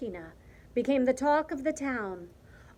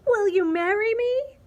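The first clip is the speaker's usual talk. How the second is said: high, loud, soaring question; elated proposal; 10 semitones higher, 8 dB louder than usual. A narrator's reading. Martina proposing to the cricket, high and thrilled. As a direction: blurt it out, overjoyed.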